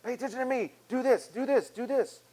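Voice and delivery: high, insistent voice that keeps demanding; nagging voice of distraction. Acted. Preacher voices busy life, high and insistent. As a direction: pester like a needy to-do list. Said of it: "they're calling out to us and saying".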